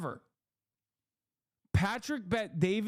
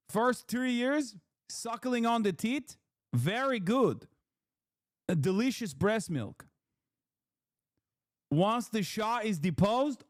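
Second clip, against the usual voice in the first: mock Persian storytelling; 3 semitones higher than usual. Streamer voicing the host's life story in mock accent. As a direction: spin the tale in a hammed-up accent.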